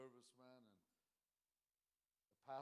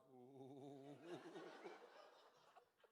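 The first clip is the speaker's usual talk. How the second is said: long 'ooh' wavering into laughter; imitated pastor's croon; 4 dB quieter than usual. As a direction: mimic the old preacher's drawn-out croon. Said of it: "gonna talk from now on".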